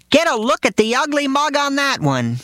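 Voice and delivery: very high, brash father voice; father yelling about a biker in the park; loud father remark. Retold or imitated. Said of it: "where he'll yell".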